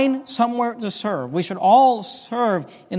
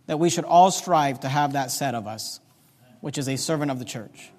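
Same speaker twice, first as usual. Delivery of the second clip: ordinary talk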